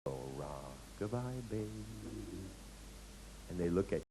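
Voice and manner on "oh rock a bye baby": soft, slow crooning; tender hushed sing-song; soothing lullaby. Retold or imitated. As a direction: lull them like a baby. Soothing the sleepless client.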